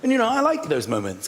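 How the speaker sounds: ordinary talk, nothing marked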